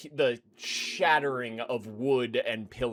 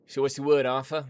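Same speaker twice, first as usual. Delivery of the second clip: a louder, higher eager ask; waiting woman; 3 semitones higher, 6 dB louder, faster than usual. Acted. Woman at the door asking Arthur, louder and eager. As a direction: press him for news. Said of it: "looks at him and goes".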